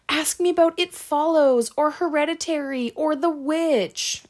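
high, loud mock-desperate plea; retold scared pleading. Scared girl pleading with the killer quizzing her, high mock panic. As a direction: act out a panicked girl begging. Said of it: "she's so scared and she's crying".

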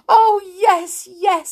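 high, loud acted sob; sobbing. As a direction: agree in a tearful high wail. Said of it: "sobbed Amelia Jane".

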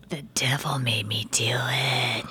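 slow, eerie demonic whisper; possessed whisper. Pretend. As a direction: breathe it out like a possessed soul. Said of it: "I feel like I'm possessed right now".

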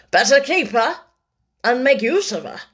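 cool, deliberate man of business; unruffled businessman. A narrator's reading.

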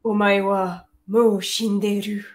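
put-on very slow, drawn-out Japanese accent. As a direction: intone the anime catchphrase in a Japanese accent. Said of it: "Voilà, l'accent japonais".

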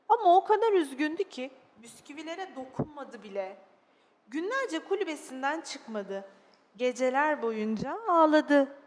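expressive, dramatised storytelling; children's story narration. Acted. Narrator telling children of a sad little dog, expressive storytelling. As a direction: read it to small children with feeling.

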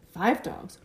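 ordinary delivery

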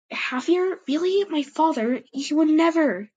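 high, loud disbelief; shocked exclaim. A narrator's reading. Silverpaw hearing news of her father, shocked and high. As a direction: refuse to believe it about her father. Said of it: "she exclaimed".

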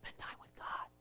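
breathy whispered aside. Shown as a demonstration. Preacher whispering to the congregation. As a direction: drop to a whisper, unvoiced. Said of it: "a principle that whispers".

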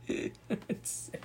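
"Insane" half-laughed, unvoiced and soft; laughing whisper. Real, not acted. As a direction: laugh the word "Insane" under your breath.

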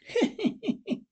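high, spooky cackle after a gag; eerie cackle. Acted. Ghoulish host to his audience, high eerie cackle.

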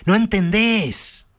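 acted outburst of frustration; furious shout. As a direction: explode at the brother. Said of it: "Terry estalla de verdad y le grita".

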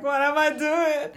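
high, drawn-out comic dismay; mock panic. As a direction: wail it slowly in pretend panic. Retelling own panic before trip.

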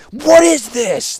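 loud, high, drawn-out outraged yell; roared. A narrator's reading. Artificer yelling at the warlord over the launch, roaring. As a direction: roar in disbelief. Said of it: "the artificer roared".

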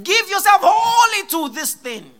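high, loud charge; loud exhortation. Retold or imitated. Paul charging Timothy, high loud exhortation. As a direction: drive the command home. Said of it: "he said".

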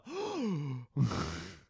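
long dramatic gasp; mock shocked audience gasp; hammed-up stock gasp; sitcom gasp. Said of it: "like the stock sitcom gasp".

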